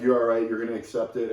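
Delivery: ordinary delivery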